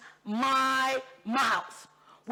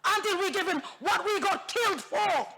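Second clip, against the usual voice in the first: impassioned shout; 6 semitones higher than usual. Real, not acted. Climax about the war dead.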